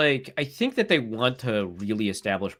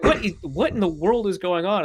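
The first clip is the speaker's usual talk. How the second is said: higher-pitched bewildered retelling; mock bewilderment, pitched up; lost and baffled; 9 semitones higher than usual. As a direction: imitate a friend who can't follow the argument. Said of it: "is, like, lost".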